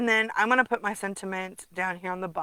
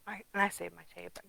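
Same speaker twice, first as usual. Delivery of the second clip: whisper; 2 semitones lower, 7 dB quieter than usual. Real, not acted. Aside to herself.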